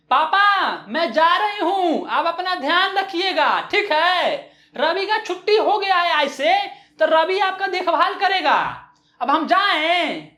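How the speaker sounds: hammed-up loud voice, each word spelled out for a child; loud, talking down. Mother leaving for office talks to grandpa like to a child, loud and high. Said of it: "इस तरीके से बोल रही थी".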